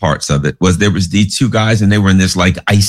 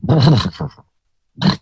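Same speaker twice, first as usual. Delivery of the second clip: imitated engine sputter dying out; generator sputtering. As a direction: make the generator cough and die. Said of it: "the generator goes brrr".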